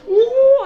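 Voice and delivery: high, loud ooh; a hammed-up helpless wail; mock wailing ooh. Imitating victims distorting in the trap, high mock wail.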